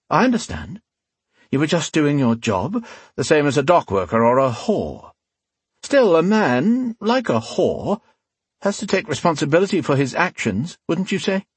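falsely understanding, leisurely threat; cool rogue, mock-sympathetic. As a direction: reason with the victim before punishing him.

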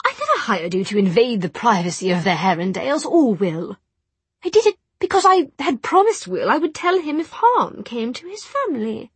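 high, urgent protest; indignant rebuke. A narrator's reading. Charlotte rebuking Ragnar for invading Will's privacy, indignant. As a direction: rise in pitch as you defend yourself.

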